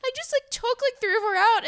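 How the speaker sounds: put-on very high child's excuse; panicky kid voice. Son defending himself to mom, high guilty squeak. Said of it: "He, like, freaked out".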